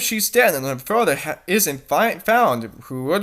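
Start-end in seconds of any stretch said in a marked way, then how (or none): none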